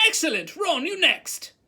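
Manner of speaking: loud, high praise and summons; praise, then shout the next pupil up; excited command. A narrator's reading. Teacher rallying the next pupil.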